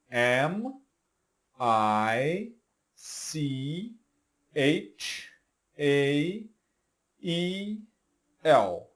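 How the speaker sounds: letters of Michael spelled out one by one; spelling Michael. Shown as a demonstration. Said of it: "vamos soletrar aqui Michael". (soletrando Michael letra por letra)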